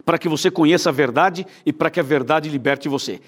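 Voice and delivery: normal talking voice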